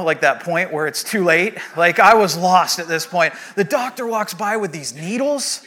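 ordinary talk

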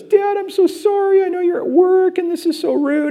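very high mock-crying wail; imitated tearful daughter. Daughter apologizes for calling dad at work, hammed-up crying. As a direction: imitate a child breaking down in apology. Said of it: "breaks down and cries".

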